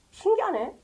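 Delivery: a quiet, ironic aside; soft mock wonder. Shown as a demonstration. Preacher mocking the Pharisees' blindness with soft ironic wonder.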